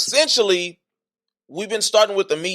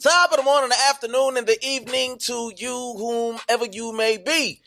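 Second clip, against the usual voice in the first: hype show intro; 8 semitones higher than usual. Acted. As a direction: pump up the crowd like a show host.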